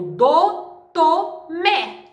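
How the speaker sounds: "do, to, mé" as separate beats, climbing; syllables counted backwards. Shown as a demonstration. Teacher counting syllables from the back, "do, to, mé" spaced out separately. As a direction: count off "do", "to", "mé" one at a time. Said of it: "de trás pra frente é a terceira".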